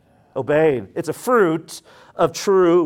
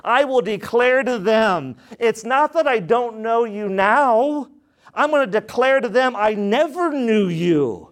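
emphatic preaching; 6 semitones higher than usual. Acted. Preaching Jesus' words.

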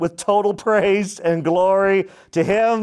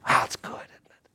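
hushed, breathy aside of delight; whisper; 6 dB quieter than usual. Real, not acted. Preacher to church after the reading, whispering. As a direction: breathe it out like a secret.

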